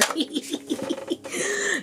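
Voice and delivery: high, soft cackling laugh; evil laugh. Shown as a demonstration. Showing off a little cackle, playful evil laugh. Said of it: "Not my evil laugh".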